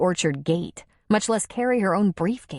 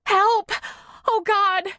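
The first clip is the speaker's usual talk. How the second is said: terrified scream; 14 semitones higher, slower than usual. Acted. Woman attacked by a bear cries for help, terrified. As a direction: cry out for help in terror. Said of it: "She screamed again and added".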